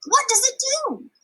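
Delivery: put-on girl asking the stranger, high and eager; curious girl. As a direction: ask wide-eyed like a little girl. Juanetta asks curiously.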